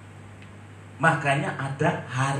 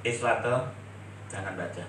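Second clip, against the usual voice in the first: a hint of a dismissive teacher, lower; teacher's quote; 7 semitones lower, 3 dB quieter than usual. Preacher retelling his teacher forbidding him to read, mild dismissive. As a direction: lightly voice the teacher stopping him reading. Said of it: "gurunya ngomong gini".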